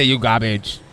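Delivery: imitating Fluke's accent. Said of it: "You can't do the accent".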